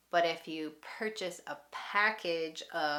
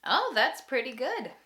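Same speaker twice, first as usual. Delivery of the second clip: pleased; 7 semitones higher, 5 dB louder than usual. Shown as a demonstration. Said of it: "you can say".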